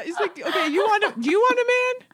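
normal talking voice

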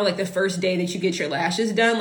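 usual speaking voice

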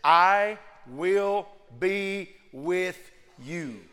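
I will be with you, drawn out very slowly; slow key phrase. Shown as a demonstration. Preacher slowing right down on God's promise. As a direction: stretch every word of I will be with you. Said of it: "here is the key phrase".

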